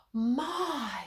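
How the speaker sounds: put-on a young girl's exclamation of surprise; exclaiming. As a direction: say it as you are amazed at the mirror. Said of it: "she exclaimed".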